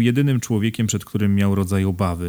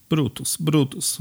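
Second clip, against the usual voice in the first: high, slow tearful calling; through sobs; 7 semitones higher, slower than usual. A narrator's reading. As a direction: whimper the name as if crying. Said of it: "mówił wśród łukania".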